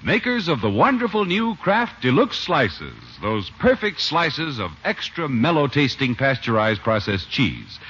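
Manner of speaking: bright, measured sponsor pitch; old-time radio commercial voice; radio ad read. Acted. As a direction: sell the cheese like a fifties announcer.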